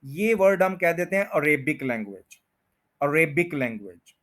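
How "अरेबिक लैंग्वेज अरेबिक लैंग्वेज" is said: अरेबिक as "a-RAY-bik", second syllable stressed; mispronounced Arabic. Shown as a demonstration. Teacher quoting the common mistake, अरेबिक as "a-RAY-bik". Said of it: "अरेबिक नहीं है ये word".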